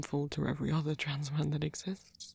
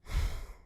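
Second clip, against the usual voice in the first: sigh. Acted. Upset by the partner's self-deprecating remark, sighing. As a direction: let out a wordless sigh.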